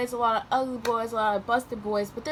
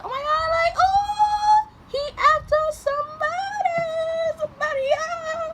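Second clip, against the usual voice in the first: very high, loud thrilled squeal; giddy at his interest; mock squeal; 6 dB louder than usual. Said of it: "I was like".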